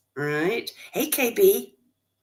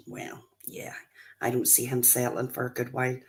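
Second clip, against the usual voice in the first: hushed aside; 5 semitones lower, 6 dB quieter than usual. Real, not acted. Confiding aside about her son.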